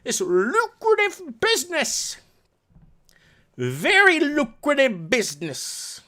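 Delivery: high slow mocking Southern drawl; Southern accent. Mocking landlords' lucrative business in a Southern accent. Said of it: "talk in a southern accent".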